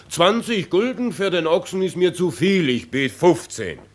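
loud, high haggling bid, hammed-up; mock bargaining with the peasants. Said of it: "ich verleg mich aufs Handeln und sag".

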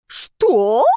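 loud outraged screech; snake's shriek. A narrator's reading. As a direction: screech in disbelief. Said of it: "от змеиного визга заложила уши".

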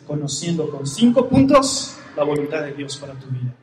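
usual speaking voice

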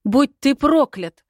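high, loud furious curse; angry shout. A narrator's reading. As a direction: hurl the curse at him. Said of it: "прокричала со всей злостью".

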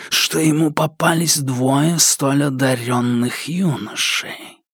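slow menacing creature voice sinking to a whisper; creeping nightmare whisper. Acted. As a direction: order it like a nightmare breathing close. The shadow taunts the boy.

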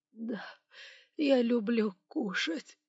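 slow, high, meek whimper; weepy little confession; tearful. A narrator's reading. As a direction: answer pitifully through tears. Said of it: "По щекам управляющего покатились слёзы".

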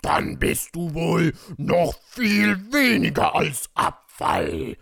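loud, slow villain threat, sinking at the end; threatening. Acted. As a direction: draw out the insult menacingly. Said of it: "drohte Shadow".

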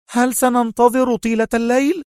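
high-pitched, edgy interruption; irritable. A narrator's reading. Sonia interrupting the two friends' farewell, irritably. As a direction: cut in impatiently, voice way up. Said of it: "وقاطعته سونيا في عصبية".

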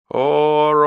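long, high howled echo; one stretched vowel carried far, high and held; echo over taiga. A narrator's reading. Echo answering across the taiga, drawn-out high call. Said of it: "над тайгой понеслось".

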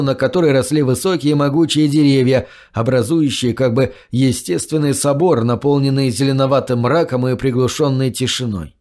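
usual speaking voice

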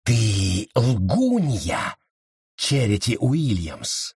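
slow, menacing witch's accusation; very slow, spiteful condemnation; hissed accusation. A narrator's reading. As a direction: draw the words out with slow venom. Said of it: "прошипела она".

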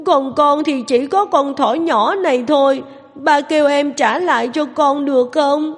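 put-on high, tearful little girl begging; choked-up pleading. A narrator's reading. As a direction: beg daddy through tears. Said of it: "nghẹn ngào mà nói". (bé gái nghẹn ngào cầu xin ba)

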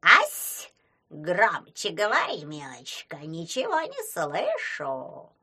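put-on slow, creaky deaf old crone; creaky old hag voice. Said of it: "скрипучим голосом говорила чёртова бабушка".

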